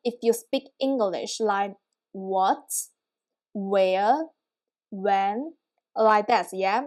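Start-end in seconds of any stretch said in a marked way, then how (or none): 2.1-2.7 "what" rising like a question. Shown as a demonstration. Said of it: "more like a questioning tone". 3.5-5.5 "where" and "when" each rising; questioning tone. Shown as a demonstration. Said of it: "more like a questioning tone".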